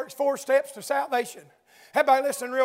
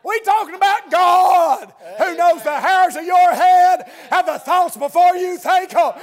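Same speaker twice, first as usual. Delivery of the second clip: louder, slower preaching climax; preaching at full force; 3 semitones higher, 5 dB louder, slower than usual. Acted. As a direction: build to a loud slow climax.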